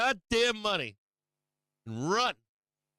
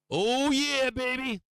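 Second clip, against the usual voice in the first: gloating cheer; 6 semitones higher, slower than usual. Real, not acted. Gloating over a bottom buy.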